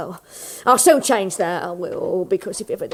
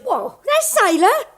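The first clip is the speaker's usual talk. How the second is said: scornful surprise; 9 semitones higher than usual. Acted.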